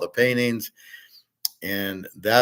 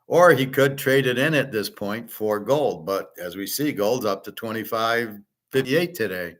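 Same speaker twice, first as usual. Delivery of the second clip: ordinary delivery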